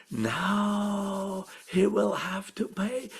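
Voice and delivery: hushed scheming whisper; the men whisper to each other, plotting; whispered aside. A narrator's reading. Men plotting in secret, whispered. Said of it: "they said to themselves".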